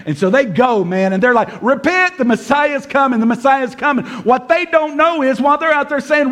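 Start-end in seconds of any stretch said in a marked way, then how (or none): 1.6-4.1 high, urgent herald's call; mock street evangelist, repeating the cry; street preacher proclaiming. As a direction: call it out to passers-by, pitched up. Acting followers proclaiming in the streets.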